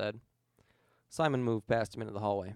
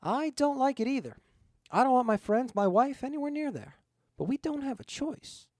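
higher, reluctant, pained; regretful ghost; 12 semitones higher, 3 dB louder than usual. Acted. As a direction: admit it with regret. Ghost admits grim necessity.

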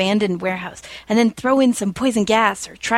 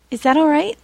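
a bit higher, mildly worried ask; lightly worried Penny; 8 semitones higher, 3 dB louder than usual. A narrator's reading. Penny asking Billy if the date is all right, mildly worried. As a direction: ask it with a touch of worry. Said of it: "a little worried".

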